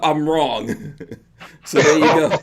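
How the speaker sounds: normal talking voice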